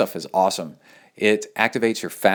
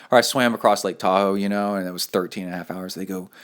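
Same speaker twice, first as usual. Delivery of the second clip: usual speaking voice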